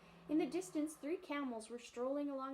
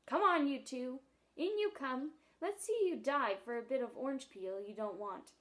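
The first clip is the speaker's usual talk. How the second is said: louder, jeering taunt; bitter angry seal; 6 dB louder than usual. Acted. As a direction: bark the dare from the pool. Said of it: "a bitter, angry voice".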